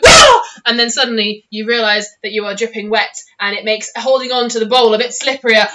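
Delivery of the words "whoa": a high, loud whoa as the catapult fires; sudden high shout of surprise; startled yell. Retold or imitated. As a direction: yelp as you get flung. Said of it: "pa-ting, like".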